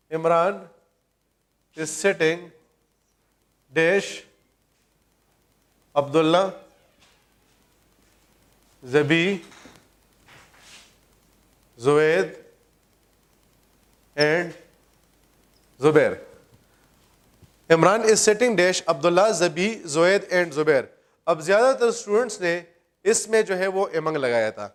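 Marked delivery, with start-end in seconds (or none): 0.1-16.1 each name dropped in alone after long pauses; dictated word by word. Shown as a demonstration. Teacher dictating a quiz question to students, one word at a time. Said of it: "paper کا ایک M C Qs ہے". 17.7-20.8 the whole question read through in one go, its second half slower; read as one sentence. Shown as a demonstration. Teacher reading the full quiz question back to the class, as one sentence. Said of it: "paper کا ایک M C Qs ہے".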